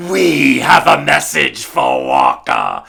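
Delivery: slow, loud cartoon villain line; hammed-up villain. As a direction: play it like a cartoon bad guy. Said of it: "It's me going".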